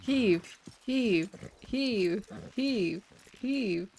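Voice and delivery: rhythmic effort chant; heave ho pulling. Real, not acted. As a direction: chant each heave in time. Rhythmic pull chant on reins.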